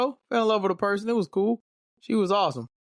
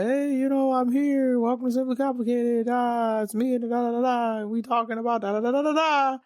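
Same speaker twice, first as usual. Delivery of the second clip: imagined co-host; 7 semitones higher than usual. Pretend. Imagined future co-host welcoming listeners, high and lively. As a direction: play the future co-host opening the show. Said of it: "And she'll be like".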